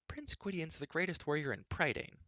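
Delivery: princess replying. A narrator's reading. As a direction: counter him in a high girlish voice. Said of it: "Elenwy replied".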